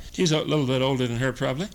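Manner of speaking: usual speaking voice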